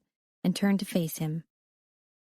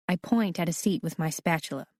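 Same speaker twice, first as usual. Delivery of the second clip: ordinary delivery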